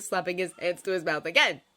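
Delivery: usual speaking voice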